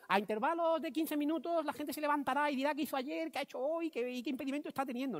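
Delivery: high, monotone mock decree; mock rule recital. Speaker parodying a rule absent from the manifesto, mock recital. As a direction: chant it like an absurd commandment.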